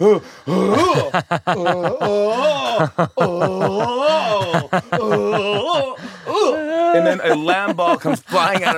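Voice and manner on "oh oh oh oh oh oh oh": high hooting Heimlich whoops; imitated long wailing "ooh" as air is squeezed out; comic high-pitched heaves, rising at the end; choking mother's noises. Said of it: "I'm hearing noises I've never heard from my mother".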